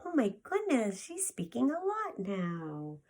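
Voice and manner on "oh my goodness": ordinary delivery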